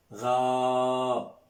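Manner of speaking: ra with a lengthened a; long drawn ra. Shown as a demonstration. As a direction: hold the vowel long and steady. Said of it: "On prolonge".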